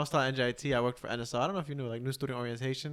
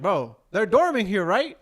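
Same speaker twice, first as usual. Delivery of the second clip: higher, louder, incredulous; exasperated disbelief; 5 semitones higher, 7 dB louder than usual. Retold or imitated. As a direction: throw up your hands at the parents. Venting about overinvolved parents.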